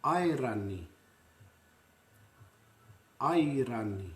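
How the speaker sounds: "Airani" with eye-ra-nee, clear even syllables; Māori pronunciation. Shown as a demonstration. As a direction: say "Airani" cleanly and briefly. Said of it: "be clear and concise".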